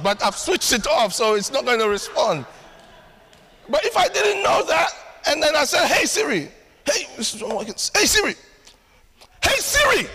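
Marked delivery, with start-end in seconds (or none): 5.9-10.1 frustrated calling out. Pretend. Calling a phone that won't answer.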